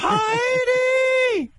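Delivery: screeching falsetto shout of Heidi; High Pitch Eric yelling. Retold or imitated. Heckler shrieking at judge Heidi from the audience. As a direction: bellow the name in a squeal. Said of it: "You'd hear him the whole show".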